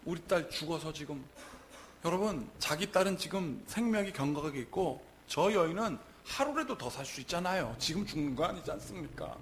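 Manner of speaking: ordinary talk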